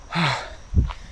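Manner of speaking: long weary exhale after the run; heavy tired sigh. Real, not acted. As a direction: breathe out, spent and tired.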